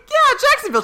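very high, loud dopey answer; Jason impression. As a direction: chirp it out like a clueless dude. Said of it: "And he's like".

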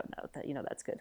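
whispered, nearly voiceless quote of the friend; quoted whisper. Retold or imitated. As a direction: breathe the friend's reply almost silently.

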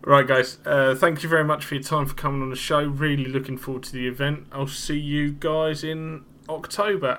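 normal talking voice

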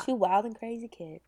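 whispered aside; breathy hushed whisper. Real, not acted.

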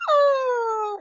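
high, loud cartoon wail while spinning; imitated skaters' cry. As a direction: let out a silly high "ah" like a whirling skater. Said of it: "they're, like, skating".

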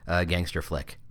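ordinary talk, nothing marked

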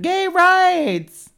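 high, loud rally chant; excited cheer. Retold or imitated. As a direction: shout it like a parade cheer. Mock cheer of a parade.

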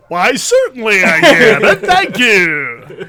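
big booming announcer voice. Shown as a demonstration. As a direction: say it like a ring announcer. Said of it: "when I put on my announcer voice".

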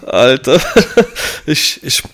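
breathless laugh through the word. Real, not acted. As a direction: laugh it off, still winded. Recovering after a choked sneeze.

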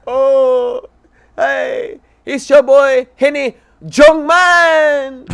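hammed-up Spanish accent. Cheesy comic telling a podcast host his jokes, in a hammed-up Spanish accent.

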